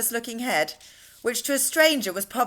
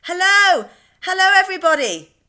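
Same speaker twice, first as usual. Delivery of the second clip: much higher, much louder joyful call; 10 semitones higher, 9 dB louder than usual. A narrator's reading. James calling out cheerfully to the men on the rooftop. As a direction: wave and shout the greeting to the crowd. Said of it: "calling out".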